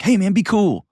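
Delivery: a loose, casual brush-off; vague dude talk. Retold or imitated. To staff, a vague casual nudge. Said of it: "We just say things like".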